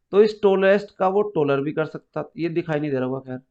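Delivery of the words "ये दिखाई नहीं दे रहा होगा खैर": ordinary talk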